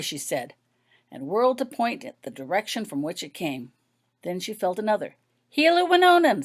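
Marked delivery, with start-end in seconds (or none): 5.6-6.4 a high, loud, sure call of the name; confident call. A narrator's reading. Blindfolded girl naming who was poked, a high confident call. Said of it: "said Holly confidently".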